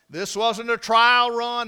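usual speaking voice

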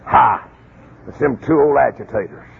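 low, muttered aside; grumbling spy. Retold or imitated. A spy grumbling at the two agitators, in a low voice. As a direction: grumble about the troublemakers. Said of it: "One of them said".

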